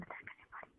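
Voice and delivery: hushed whisper. Real, not acted. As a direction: drop to a whisper. Shy aside during intimate call.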